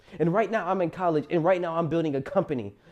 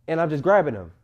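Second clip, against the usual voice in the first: ordinary talk, nothing marked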